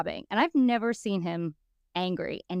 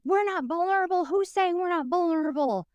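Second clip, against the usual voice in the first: high, heated listing; hammed-up angry listing; mock outrage; 9 semitones higher than usual. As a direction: tick them off in fury. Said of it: "I'm like".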